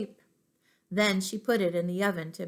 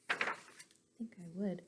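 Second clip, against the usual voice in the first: soft whispered admission; whispered aside; 11 dB quieter, faster than usual. Real, not acted. Teacher confessing to her class in a whisper. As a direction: whisper it like a little secret. Teacher answers own question softly.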